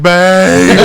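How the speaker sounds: mock desperate yell; calling out. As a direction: holler for your partner in the next room. Calling partner for help.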